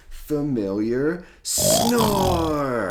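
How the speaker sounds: loud, slow, drawn-out snore; booming snore. A narrator's reading. Dad reading to kids, hams up the snore. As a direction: stretch the snore big.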